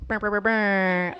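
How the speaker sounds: loud, slow rolled "brr" noise; imitated noise. Speaker explaining being loud, loud imitated trilling. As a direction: trill it out loudly, drawn out.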